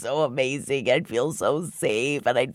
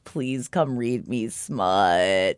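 low, slow, melting sigh; swooning; 5 semitones lower, 4 dB quieter, much slower than usual. Pretend. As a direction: swoon over the words. Swooning over Cassian.

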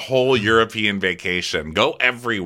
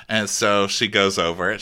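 ordinary talk

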